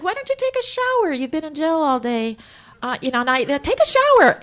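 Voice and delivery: high, loud fake-bright mom voice; sing-song it to get him out of the room; overly chirpy loud suggestion; fake cheerful. Pushing son to shower, fake bright.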